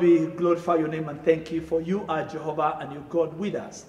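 ordinary talk

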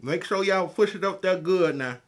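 talking with fingers on teeth; speaking through cupped hands; hands at mouth. Real, not acted. Seen 0: Both hands hold something against upper teeth throughout the speech.

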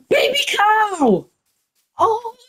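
high, slow and drawn out in delight; delighted squeal. Real, not acted. Spots a baby cow, delighted.

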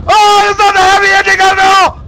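very high, loud shriek; panicked scream. Real, not acted. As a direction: scream the whole line in sudden terror. Sudden scare contradicts happy ending.

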